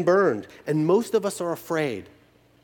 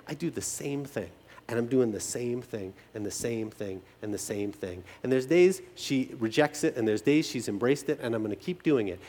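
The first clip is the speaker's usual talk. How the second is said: quiet and tender; 6 semitones lower, 6 dB quieter than usual. Real, not acted. Tender persistence, much quieter.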